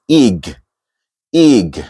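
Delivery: "ig" with a short i sound; isolated first syllable. Shown as a demonstration. Teacher isolating the first syllable, "ig" with short i. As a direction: say "ig" alone, not "egg". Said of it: "it shows you the pronunciation is".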